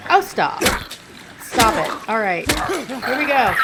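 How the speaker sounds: loud, urgent stop it; frantic pleading. Real, not acted. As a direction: beg the monster to quit. Fending off attacking enemy.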